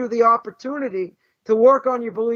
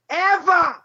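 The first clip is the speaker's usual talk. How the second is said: "Ever!" said Boston style, like "Evah"; a high Boston accent on "Ever!"; 7 semitones higher than usual. Retold or imitated. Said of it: "As we would say in Boston".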